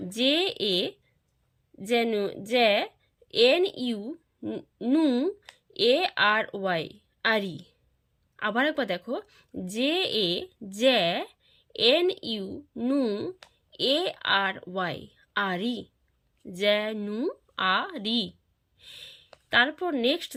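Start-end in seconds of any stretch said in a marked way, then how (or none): 0.1-15.8 letters first, then the syllable they make; syllable-by-syllable spelling. Shown as a demonstration. Teacher showing pupils how to spell January, syllable by syllable. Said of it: "syllable ভাগ করেই পড়ি". 16.5-18.3 "জেনুয়ারি" slowly split as je-nu-a-ri; syllable by syllable. Shown as a demonstration. Teacher showing pupils how "জেনুয়ারি" divides, syllable by syllable. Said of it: "syllable ভাগ করেই পড়ি".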